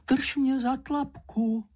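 high, stretched plea; frightened call. A narrator's reading. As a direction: plead in a high frightened little voice. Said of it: "volala slečna Ferka". (úzkostné volání vysokým hláskem)

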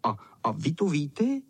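usual speaking voice

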